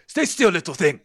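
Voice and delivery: high, loud lizard guide; lizardfolk character. Acted. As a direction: scold them as an impatient creature. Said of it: "he just goes".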